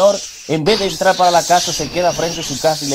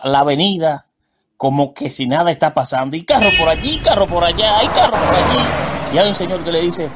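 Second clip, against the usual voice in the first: ordinary talk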